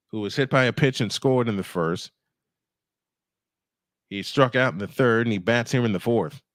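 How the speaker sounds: ordinary talk, nothing marked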